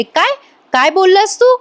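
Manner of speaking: shocked disbelief. Retold or imitated. Kala shocked.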